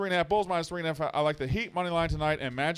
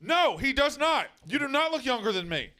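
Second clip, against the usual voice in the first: indignant protest; 10 semitones higher than usual. Real, not acted. Arguing with a co-host about his age, high indignant denial. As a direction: insist he's wrong, voice jumping up.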